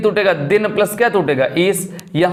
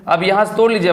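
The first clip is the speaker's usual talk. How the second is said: ordinary talk, nothing marked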